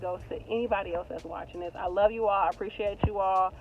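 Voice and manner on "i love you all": usual speaking voice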